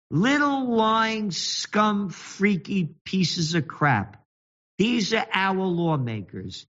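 high, very slow sneering insults; contempt. Real, not acted. Ranting host spits slow high insults at lawmakers. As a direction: drag out each insult with disgust.

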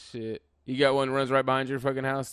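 ordinary delivery

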